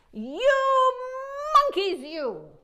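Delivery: high, loud scolding peddler. A narrator's reading. Peddler scolding the thieving monkeys, high and loud. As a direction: wag a finger and scold the monkeys. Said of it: "he said, shaking a finger at them".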